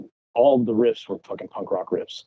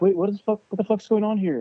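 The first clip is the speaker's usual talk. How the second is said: higher-pitched, incredulous protest; retold disbelief; 11 semitones higher than usual. Guitarist recalling hearing odd samples on his demo, incredulous. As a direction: replay your baffled reaction, voice pitched up. Retelling his baffled reaction in studio.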